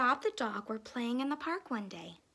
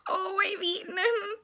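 high, loud mock wail; hammed-up tearful cry; crying dog; 8 semitones higher, 5 dB louder than usual. A narrator's reading. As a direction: wail it out in a storybook sob. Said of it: "he cried".

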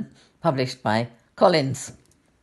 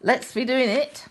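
higher, eager little bear call; brave little bear; 10 semitones higher than usual. Acted. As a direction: urge the others on brightly. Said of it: "And he's saying".

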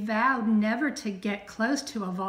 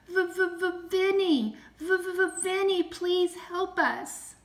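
high, stuttering plea; frightened cry for help; 9 semitones higher, slower than usual. A narrator's reading. Trapped friends calling Vinnie to save them, frightened stutter. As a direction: stammer on the V, pitched way up.

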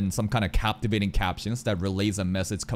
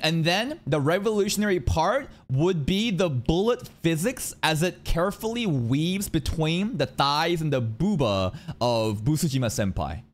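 ordinary talk, nothing marked

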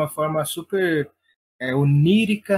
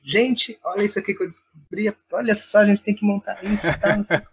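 reenacting his own excited pitch to friends, higher; higher, eager mock rush of discovery; excited retelling; 5 semitones higher than usual. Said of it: "eu cheguei contando pra todo mundo".

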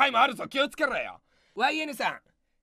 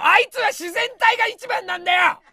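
much higher and louder comic yell; mock-angry defense; 9 semitones higher, 8 dB louder than usual. Comedian defending his partner against a commenter, mock-angry yell. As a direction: shout the defense in fake outrage.

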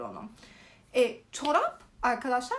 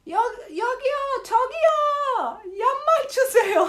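high, loud, slow laughing yell; laughing shout; 12 semitones higher, 9 dB louder, much slower than usual. Retold or imitated. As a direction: holler for socks through laughter. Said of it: "bağırıyorsunuz restoranlarda".

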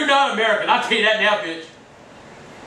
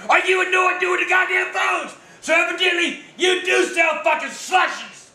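raging rant down the phone; furious shout; 6 semitones higher than usual. Real, not acted. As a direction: hammer each insult at him. Ranting at the phone agent.

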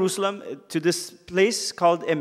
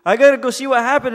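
eager Peter; 9 semitones higher, 10 dB louder than usual. Acted. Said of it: "And Peter's like".

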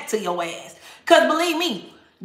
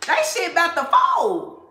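emphatic announcement; 5 semitones higher than usual. Shown as a demonstration. Telling viewers the tarot platforms will fold, raised and emphatic. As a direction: proclaim it like a prophecy. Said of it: "that's exactly what I just heard".

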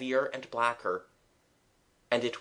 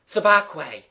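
loud, higher, a touch winded; lightly panted; 5 semitones higher, 7 dB louder than usual. A narrator's reading. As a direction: call it out slightly breathless. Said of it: "Gerald panted".

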